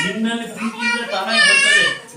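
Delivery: high crying falsetto wail; hammed-up weeping repeat of the line; mock wail. Shown as a demonstration. Preacher repeats line, wailed high.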